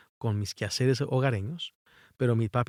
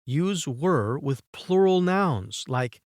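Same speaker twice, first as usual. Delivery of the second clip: very slow English reading; slow rule reading; slower than usual. Shown as a demonstration. Teacher reading a grammar rule to students, very slow. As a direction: read the rule slowly, word by word. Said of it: "Utiliza were con sustantivos plurales".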